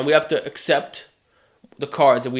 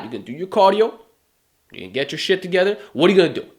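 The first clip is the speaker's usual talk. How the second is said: usual speaking voice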